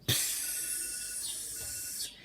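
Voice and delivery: soft unvoiced psst of spraying; a tiny hissing spray sound; wee spray hiss. The rat's wee sprays toward Zoe. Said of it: "yellow liquid sprayed".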